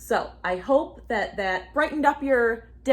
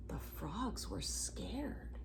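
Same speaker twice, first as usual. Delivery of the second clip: whisper; 2 semitones lower, 15 dB quieter, much slower than usual. A narrator's reading. Spooky narration.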